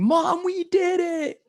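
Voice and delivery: high, loud, drawn-out yell; child's excited yell. Retold or imitated. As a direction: yell it for Mom, stretching the words. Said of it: "she's, like, yelling".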